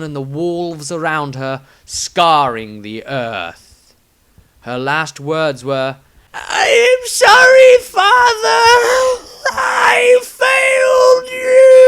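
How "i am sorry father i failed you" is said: very high, loud crying shout; anguished cry. Acted. Old woman crying her last words to her father. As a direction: wail out the last words in despair.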